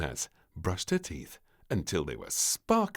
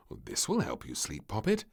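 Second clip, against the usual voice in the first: usual speaking voice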